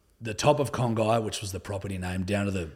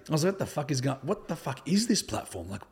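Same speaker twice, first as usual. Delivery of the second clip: higher-pitched, incredulous retelling; baffled disbelief; 7 semitones higher than usual. Retold or imitated. Telling interviewer about sudden TikTok followers, baffled disbelief. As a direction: re-enact being baffled by it. Recalls bafflement at going viral.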